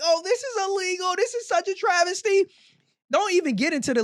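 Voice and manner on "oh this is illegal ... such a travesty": high whiny mock wail; high, put-on whine mocking the crier; mock crying complainer. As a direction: parody a crybaby's outrage. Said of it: "wanna go cry and be like".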